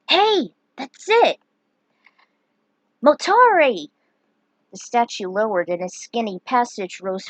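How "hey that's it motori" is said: loud, high triumph; eureka cry. Acted. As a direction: shout out the discovery. Luneth's eureka moment.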